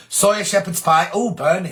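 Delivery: normal talking voice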